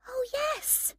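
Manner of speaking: put-on very high-pitched girl's voice; excited girl. A narrator's reading. Girl recognises a TV presenter, excited.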